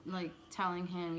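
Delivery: ordinary delivery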